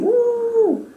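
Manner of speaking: high, loud whoop of a cheering crowd; squealed crowd woo; mock cheer. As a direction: let out a happy cheering whoop. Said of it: "you see everybody screaming".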